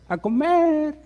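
loud, high call to dinner; wife calling the family from the kitchen. Retold or imitated. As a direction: shout across the house to come eat. Wife calls family to eat.

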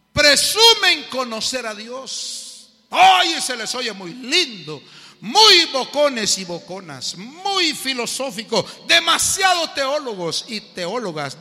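loud, high, slow pulpit preaching; full-force preaching. Acted. Preacher drives home the lesson to his congregation, at full force.

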